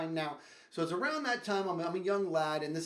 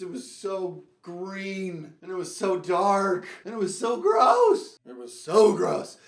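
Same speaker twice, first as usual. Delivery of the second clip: slow, drawn-out and louder disgust; disgusted emphasis; 8 dB louder, slower than usual. Real, not acted. Storyteller revolted, slow loud disgust. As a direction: stretch out how gross it was.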